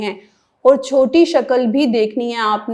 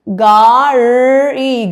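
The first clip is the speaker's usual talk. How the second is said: گاڑی drawn out very slowly and louder; slow stretched word; 5 dB louder, much slower than usual. Shown as a demonstration. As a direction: stretch every sound of گاڑی. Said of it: "teacher demonstrates the word slowly".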